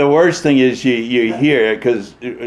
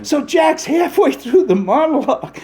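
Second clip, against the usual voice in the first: ordinary delivery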